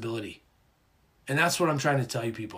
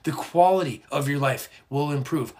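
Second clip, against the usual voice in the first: ordinary talk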